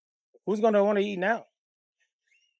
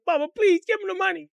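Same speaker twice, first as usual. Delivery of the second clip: put-on high crying-out child's plea; acted wail, pitched way up; begging; 12 semitones higher, 3 dB louder than usual. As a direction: plead like a kid clinging to Mama. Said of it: "begging her".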